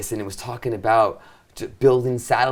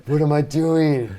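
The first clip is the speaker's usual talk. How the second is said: awestruck; 6 semitones higher, slower than usual. Retold or imitated. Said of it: "I was like".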